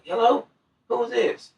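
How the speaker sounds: imitating a woman answering, pitched high; a high, light female voice answering the phone; mock woman's voice on the phone.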